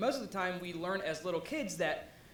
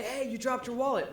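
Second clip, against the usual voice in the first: higher-pitched mock call-out; calling after someone; 5 semitones higher, 3 dB louder than usual. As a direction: call lightly after a passerby. Acting out calling after wallet's owner.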